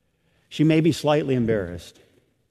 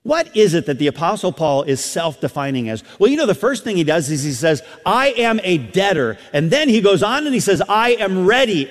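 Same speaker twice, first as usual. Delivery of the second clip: higher, louder pulpit delivery; full-force preaching; 7 semitones higher, 5 dB louder than usual. Real, not acted. Preacher urging the congregation to see daily life anew, impassioned preaching. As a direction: preach it out high and driving.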